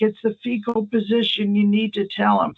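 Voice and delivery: high, insistent mock pleading; imitated weepy drunk. Drunk visitor insisting to the nurse, mock weepy. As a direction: press it urgently, like a tearful drunk. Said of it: "drunks can cry at the drop of a hat".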